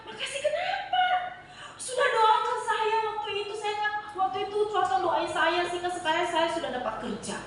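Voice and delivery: high, laughing thanks; grateful woman. Retold or imitated. Woman tells the preacher his prayer got her a job, laughing happily.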